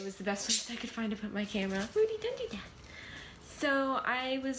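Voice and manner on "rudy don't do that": sharp high call to the dog; high scolding dog voice. Real, not acted. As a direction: tell the dog off, pitched up.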